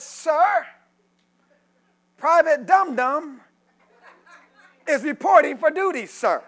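loud clipped military report; crisp loud soldier report. Retold or imitated. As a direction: snap to attention and report.

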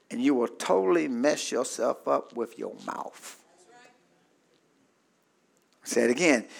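ordinary talk

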